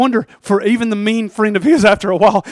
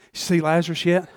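ordinary delivery

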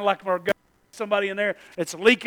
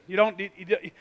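ordinary delivery

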